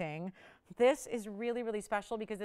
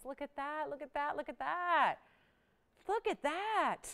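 high, drawn-out marvelling; admiring coo; 5 semitones higher than usual. Real, not acted. Host showing off a ring's sparkle, admiring coo. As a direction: coo over it, pitch lifted.

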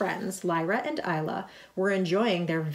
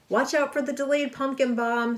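higher, raised call after someone; calling after her; 7 semitones higher than usual. A narrator's reading. Spider-Man warning Captain Marvel as she flies off, calling after her. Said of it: "Spider-Man called after her".

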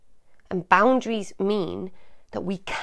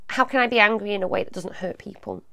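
usual speaking voice

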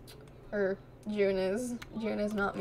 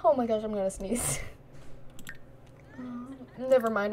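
ordinary talk, nothing marked

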